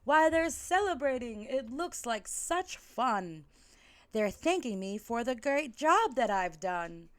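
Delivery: lightly voiced Jack, thrilled and proud; mild excited character voice; delighted Jack. Jack delighted, misreading the screams.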